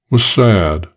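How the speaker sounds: usual speaking voice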